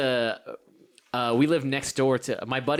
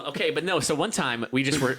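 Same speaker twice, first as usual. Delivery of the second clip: usual speaking voice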